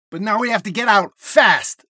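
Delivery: loud, urgent yell. Acted. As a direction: yell to keep everyone moving. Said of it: "Aaliyah yells".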